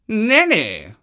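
high, loud haughty refusal; a proud cat sniffing no. Acted. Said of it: "said the cat".

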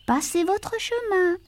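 put-on high, careful girl's refusal. A narrator's reading. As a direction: turn the stranger away gently. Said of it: "dit-elle".

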